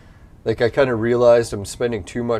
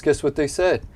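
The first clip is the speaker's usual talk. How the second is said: ordinary delivery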